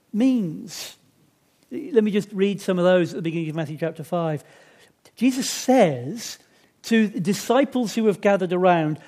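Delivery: usual speaking voice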